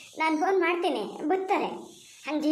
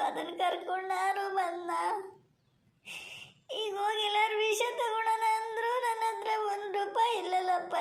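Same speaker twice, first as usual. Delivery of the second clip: tearful lament; 3 semitones higher, 5 dB quieter than usual. Acted. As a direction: plead with God through sobs. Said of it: "ಅಳ್ತಾ ಕೂತಾರಲ್ಲ ಪಾಪ". (ಅಳುತ್ತಾ ಗೋಳಾಡುವ ಧ್ವನಿ)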